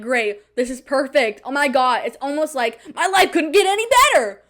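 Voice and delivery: mock cheerful, dripping with sarcasm; sarcastic. Sarcastic self-talk in the rain.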